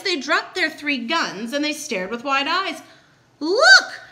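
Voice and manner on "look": high, loud startled cry; shouting. A narrator's reading. Hunters staring at elephant in tree, shouting. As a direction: shout it out in amazement. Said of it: "they all shouted".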